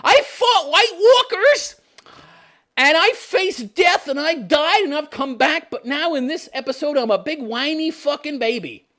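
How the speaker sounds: a crybaby mocking the hero's weepy speech; whiny crybaby Jon. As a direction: whimper it like a sulking big baby. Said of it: "He's a big fucking crybaby".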